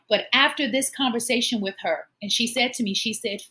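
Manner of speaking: ordinary talk, nothing marked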